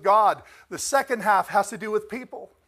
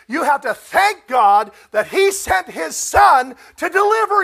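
loud, higher preaching, building in force; full-force preaching; 7 semitones higher, 10 dB louder than usual. Acted. Preacher challenging the congregation about thankfulness, full-force preaching. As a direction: drive it home to the congregation, louder and louder.

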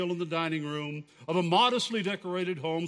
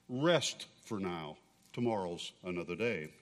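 gently; 9 semitones lower, 6 dB quieter than usual. A narrator's reading. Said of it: "touching his sleeve gently".

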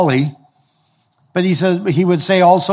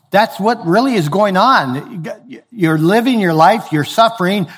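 usual speaking voice

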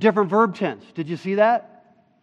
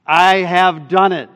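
loud, slow, weighty declaration; emphatic proclamation; 9 dB louder, slower than usual. Acted. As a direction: declare it as God, word by word. Proclaims God's words.

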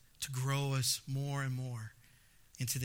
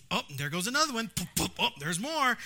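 mild mock frustration; 5 semitones higher, 4 dB louder than usual. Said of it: "I'm getting all frustrated".

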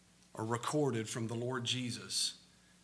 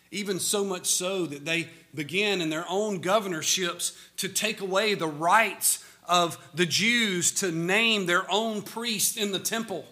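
louder, higher, driving sermon voice; full-force preaching; 7 semitones higher, 7 dB louder than usual. Acted. Preacher pressing the famine story on his congregation, full-force preaching. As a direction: preach it out to the whole church.

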